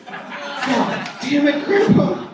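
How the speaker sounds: exasperated. Real, not acted. Exasperated groan.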